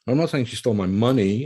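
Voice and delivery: "money" punched at the end; stress on MONEY. Shown as a demonstration. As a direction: save the weight for "money". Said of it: "take money".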